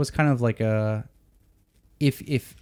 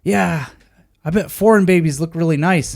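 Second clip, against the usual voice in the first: higher, lightly voiced old man; a hint of an old driver, pitched up; old taxi driver; 6 semitones higher than usual. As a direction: play the chatty elderly cabbie. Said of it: "And he's like".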